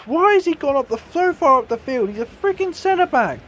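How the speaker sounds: high-pitched angry disbelief; exasperated outburst. Real, not acted. Gamer raging at his centre back, shrill outrage. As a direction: yell at the clueless defender. Defender out of position.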